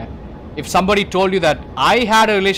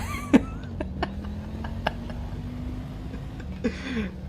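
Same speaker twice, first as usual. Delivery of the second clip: wheezing laugh; 9 dB quieter than usual. Real, not acted. Laughing at the retold scene.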